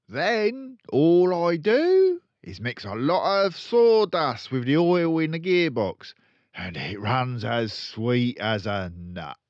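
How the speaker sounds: loud, high, gleeful crook; boastful dealer. Acted. Father boasting to his son Mike, loud and gleeful. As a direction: gloat over the trick. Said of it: "he turned to his son and said".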